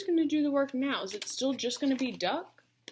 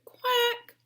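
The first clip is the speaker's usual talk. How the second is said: duck quack; 10 semitones higher than usual. Acted. Duck answering.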